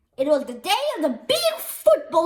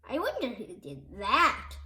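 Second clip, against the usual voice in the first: lower, softer mock-innocent question; quiet wonder; 7 semitones lower, 6 dB quieter than usual. A narrator's reading. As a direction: murmur it wondering. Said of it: "asked another fan".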